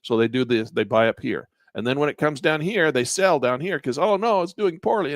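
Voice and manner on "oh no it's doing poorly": high-pitched, imitated seller's worry; mock dismay. Retail traders selling down here, mock dismay. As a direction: play a worried seller, lightly.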